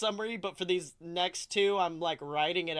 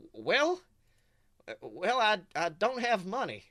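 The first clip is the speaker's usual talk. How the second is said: hesitant goofy cartoon dad, lightly voiced. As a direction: stammer a little in a silly cartoon voice. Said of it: "Goofy responds solemnly".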